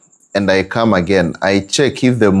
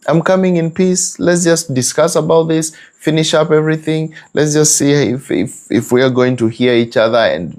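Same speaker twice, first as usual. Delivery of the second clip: high, coaxing peace-making voice; placating husband; 7 semitones higher than usual. Retold or imitated. Husband coaxing his angry wife, high and placating. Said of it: "He has come in peace".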